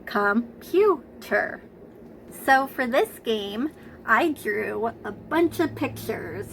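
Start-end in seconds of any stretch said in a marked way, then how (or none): 0.0-1.6 "computer" very slowly, in three separate syllables. Shown as a demonstration. Teacher recapping the sounds for preschoolers, syllable by syllable. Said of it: "how many sounds make the word".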